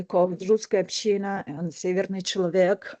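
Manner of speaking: ordinary talk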